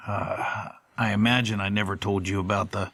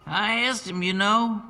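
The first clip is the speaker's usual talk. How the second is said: high, offhand voice of a big warehouse man; Bert confiding; 13 semitones higher than usual. A narrator's reading. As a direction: drop it casually like the forklift driver. Bert talks to narrator.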